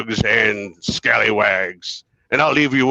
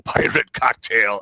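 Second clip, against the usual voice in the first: ordinary talk, nothing marked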